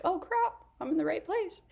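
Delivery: high, amazed exclamation. Retold or imitated. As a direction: gasp at the coincidence. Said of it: "I was like".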